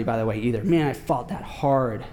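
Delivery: ordinary talk, nothing marked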